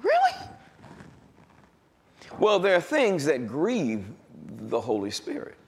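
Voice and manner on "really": really high incredulous squeak. Retold or imitated. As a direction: ask it in pitched-up disbelief. Mock incredulous question.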